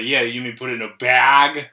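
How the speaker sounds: "bag" stretched long and loud; mock it with a long, open A in "bag"; mocking drawn-out A. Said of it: "she'll make fun of us".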